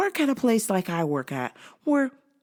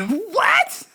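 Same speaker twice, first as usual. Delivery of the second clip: high, startled yelp of a word; shocked coworker; 11 semitones higher than usual. Acted. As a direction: imitate stunned disbelief, pitched way up.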